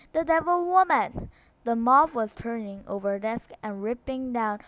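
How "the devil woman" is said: loud, high denouncing yell; shouting. A narrator's reading. Mob leader denouncing the schoolteacher, loud. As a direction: scream the accusation at her.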